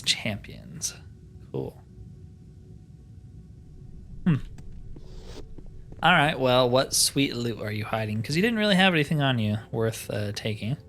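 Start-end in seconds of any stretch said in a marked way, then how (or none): none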